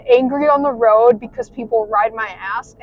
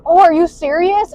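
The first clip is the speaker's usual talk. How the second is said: higher and louder, mock-shocked; retold surprise; 6 semitones higher, 6 dB louder than usual. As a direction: replay the moment you heard the bad news. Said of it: "So I was like".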